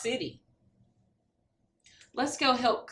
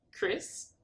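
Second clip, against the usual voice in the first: usual speaking voice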